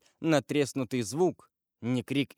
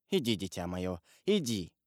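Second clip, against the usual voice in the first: tender; 5 semitones lower, 5 dB quieter than usual. A narrator's reading. Father kisses son, sends him.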